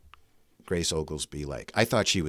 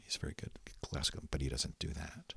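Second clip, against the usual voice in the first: hushed aside; 4 semitones lower, 14 dB quieter than usual. Retold or imitated. Teacher's aside about her son.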